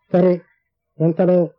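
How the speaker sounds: ordinary delivery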